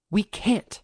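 sharp, tense, held-down snap; clipped angry snap kept between three people; tight snap. Acted. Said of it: "Kane snapped in a tight voice".

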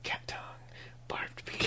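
dropping to a whisper. Real, not acted. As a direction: whisper the crude part. Crude detail half-whispered.

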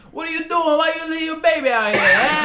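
high, loud, hammed-up Italian; mock Italian accent. Correcting a friend's impression of the ladies, mock Italian accent. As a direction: scold in a cartoon Italian accent. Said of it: "Italian, we changed them".

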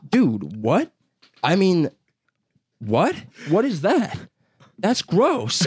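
drawn-out question climbing into high-pitched bafflement; disgusted disbelief. Retold or imitated. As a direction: play up appalled disbelief, pitch shooting up. Retold disbelief at a gross soup.

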